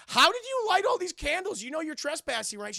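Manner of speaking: high, loud startled demand; mock outraged shout. [name] confronting Desiree in the barn, high loud alarm. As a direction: challenge an intruder in hammed-up alarm.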